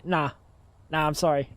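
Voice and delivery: normal talking voice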